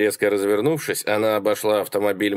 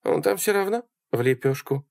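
high female character voice; high-pitched offhand remark; flippant Sally; 7 semitones higher than usual. A narrator's reading. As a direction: dismiss it in her high voice. Sally airily dismisses the dead man.

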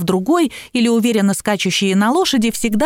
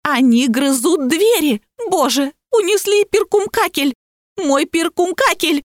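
high, distraught plea for help; panicked cry; 6 semitones higher, slower than usual. A narrator's reading. Barbie begging police to come quickly, high panicky wail. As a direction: whine in mock panic, dragging the key words. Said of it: "кричала Барби".

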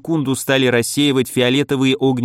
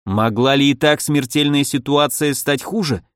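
ordinary delivery

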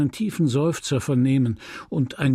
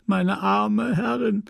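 meine arme Herrin much higher and slow, voice breaking; sobbing lament; 9 semitones higher, slower than usual. Acted. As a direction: let the grief break through, slowly. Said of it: "Félicité schluchzte".

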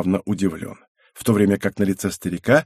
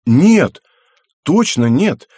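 louder, higher sudden jolt of insight; startled clan member realizing the truth, loud and quick; sudden realization; 6 semitones higher, 7 dB louder, much slower than usual. Acted. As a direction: snap upright as it clicks. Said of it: "вскинулся".

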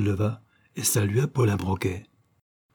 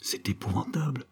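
breathless whisper of horror; hushed, shocked whisper; horrified murmur; 4 semitones higher, 7 dB quieter than usual. A narrator's reading. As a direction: whisper it, appalled. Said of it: "murmura Miss Eden".

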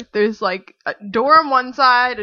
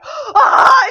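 much higher, louder shriek of relief; relieved shriek; 13 semitones higher, 7 dB louder than usual. Retold or imitated. Friend Erica running out to hug her, shrieking.

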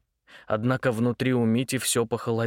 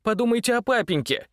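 pleading shout; 10 semitones higher than usual. Acted. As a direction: cry out, begging her. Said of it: "крикнул он". (умоляющий крик)

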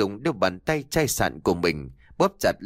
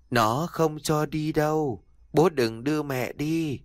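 slow, eerie warning; eerie child; 5 semitones higher than usual. A narrator's reading. Daughter warns her father again, slow and eerie. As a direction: speak slowly like a strange girl.

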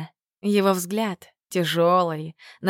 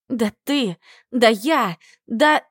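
rising, louder sputtering fury; sputtering rage; 3 semitones higher, 3 dB louder, slower than usual. Acted. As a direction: choke on your own anger. Задыхается от ярости. (захлёбываясь от ярости)

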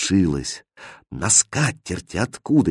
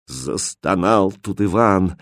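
ordinary delivery, nothing marked

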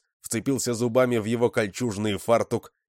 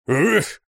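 loud high grunt of a blow; effort grunt; 10 semitones higher, 7 dB louder than usual. A narrator's reading. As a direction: grunt as you swing hard. Толстяк бьёт с размаху. (крякнуть от удара)

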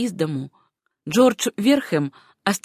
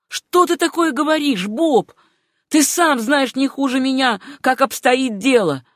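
agitated protest; 6 semitones higher, 4 dB louder than usual. A narrator's reading. Acted agitated retort to the doctor.

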